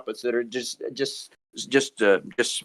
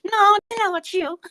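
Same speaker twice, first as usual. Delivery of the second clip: very high chimp puppet talking back; squeaky-high cheeky young chimp; Johnny; 3 dB louder than usual. Acted. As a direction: retort in a tiny, high puppet voice. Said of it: "you got the wrong voice".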